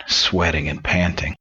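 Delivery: normal talking voice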